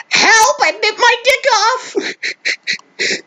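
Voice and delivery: very high, drawn-out mock scream; screamed cry for help. A narrator's reading. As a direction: scream it in a strained, piercing falsetto. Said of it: "He was screaming louder and louder, saying".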